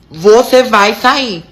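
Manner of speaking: bem devagar, cada palavra marcada; ordem enfática. Retold or imitated. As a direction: repita a ordem pausadamente, com firmeza. Repete a ordem com ênfase. (ordem retomada, lenta e firme)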